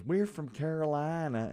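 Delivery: drawn-out fake Southern belle accent; mocking the TV mom's Southern belle accent. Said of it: "real Southern belle".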